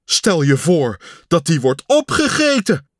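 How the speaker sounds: calling out. Acted. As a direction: cry it out in alarm. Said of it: "riep Pat". (luid roepend)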